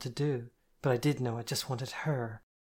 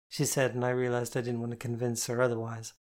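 usual speaking voice

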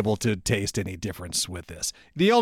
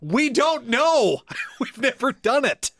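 high, drawn-out mock cluelessness; clueless makers' mock reply; 9 semitones higher, slower than usual. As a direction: play them helplessly clueless, pitched up. Acting out clueless whiskey makers.

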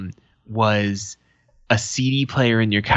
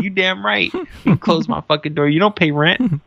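mock stern dad; 8 semitones higher than usual. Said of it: "I'm like".

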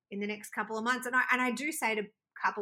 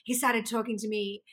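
usual speaking voice

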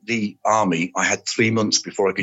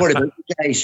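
put-on higher-pitched Irish accent greeting; 6 semitones higher than usual. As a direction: put on a wee Irish accent, chatty and high. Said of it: "talking in my wee Irish accent".

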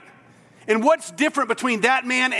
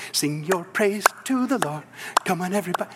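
mock worship leader; 4 semitones lower, 3 dB quieter than usual.

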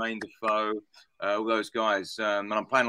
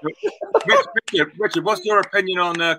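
laughing; 8 semitones higher, 9 dB louder than usual. Real, not acted. Teasing question amid laughter.

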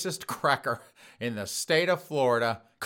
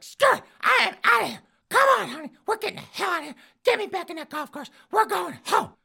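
put-on high, loud huffy old lady; old woman voice; 6 dB louder, faster than usual. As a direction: squawk it out like an outraged old lady. Voicing the angry old woman.

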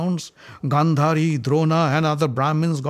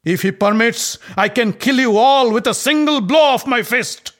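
loud, higher threatening roar; enraged Bhima shouting a death threat; furious yell; 11 semitones higher, 9 dB louder than usual. A narrator's reading. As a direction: bellow the threat at him. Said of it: "yelled at Duryodhana".

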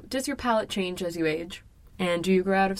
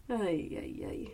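whispering; 12 dB quieter than usual. Real, not acted. Whispered dismay on wrong call.

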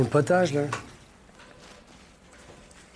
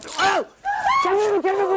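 urgent raised yell to break it up; shouting to calm. Acted. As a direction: shout them down, pitched high. Guard breaks up a scuffle.

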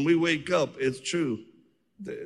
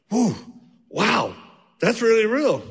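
higher, louder mock amazement; amazed; 8 semitones higher, 5 dB louder than usual. Freed man amazed at deliverance, pitched up. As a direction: gasp it out, astonished. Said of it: "he's like".

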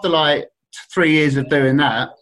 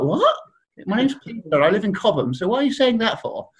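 higher, baffled indignant schoolboy; a lightly hammed-up outraged posh kid; indignant squeak; 7 semitones higher than usual. Surrey schoolboy answering the street-talking kids, higher and baffled.

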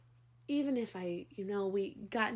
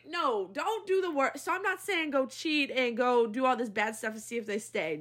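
normal talking voice

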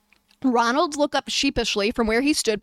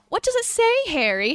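shy Hermione; 9 semitones higher than usual. A narrator's reading. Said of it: "Hermione questioned shyly".

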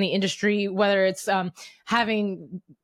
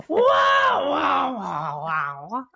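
high squawking seagull shout; Scuttle impression; 4 semitones higher, much slower than usual. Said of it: "That's my Scuttle impression".

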